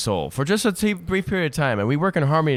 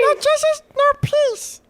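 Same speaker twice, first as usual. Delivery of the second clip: high, squeaky squirrel chatter; slower than usual. Acted. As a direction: chant like a cartoon squirrel. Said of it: "I'm only gonna do it in my squirrel voice".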